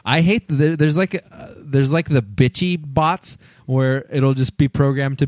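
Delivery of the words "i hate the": usual speaking voice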